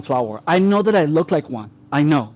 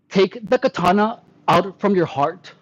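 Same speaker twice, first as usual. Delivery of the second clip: ordinary talk, nothing marked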